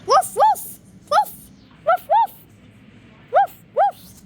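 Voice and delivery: very high, loud squeaky yips; high fox woofs. Pretend. Child guessing the sound a fox makes, high loud barks. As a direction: bark like a cartoon fox. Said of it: "sound a fox makes".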